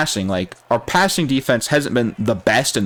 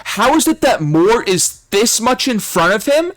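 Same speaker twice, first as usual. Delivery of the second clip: frustrated yelling; 9 semitones higher than usual. Real, not acted. Raging at the game.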